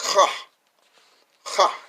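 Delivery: "cha" starting on a throaty rasped H; teacher demonstrating "cha" with a raspy CH; rasping H. Shown as a demonstration. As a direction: rasp out "cha", short and loud. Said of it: "like a rasping H".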